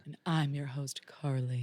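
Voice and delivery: low, soft sultry whisper. Acted. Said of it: "in a really sultry voice".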